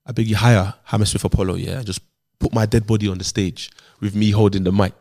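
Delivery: low, put-on voice for a solemn last request; deadly earnest mock confession, pitched low; grave confiding voice. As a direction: make a dying wish in a deep murmur. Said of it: "It's the voice".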